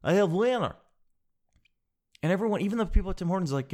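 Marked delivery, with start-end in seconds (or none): 0.0-0.8 higher-pitched imitation of an excited winner; mock excited yell. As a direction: announce the win in a put-on voice. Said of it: "I'm hoping he was saying winner".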